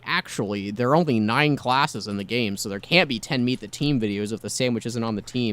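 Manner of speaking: nerd voice. Retold or imitated. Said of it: "made a joking, like, nerd voice".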